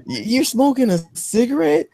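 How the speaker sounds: high, incredulous put-on voice; shocked disbelief. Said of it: "I was like".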